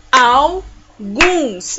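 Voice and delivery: AL, pause, GUNS, loud; syllable by syllable. Shown as a demonstration. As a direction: split ALGUNS into two strong beats. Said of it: "Eu dei duas palminhas".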